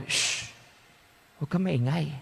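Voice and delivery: soft shushing plea; hushed. Acted. Priest in hell hushing the lay minister, quiet. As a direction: hush him down, quietly urgent.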